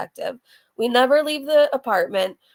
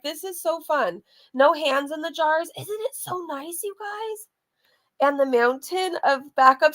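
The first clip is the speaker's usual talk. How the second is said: ordinary delivery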